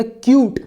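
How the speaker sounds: क्यूट with a long, clear yoo sound; cute, long vowel. Shown as a demonstration. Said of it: "पहले यू की आवाज़ आ रही थी".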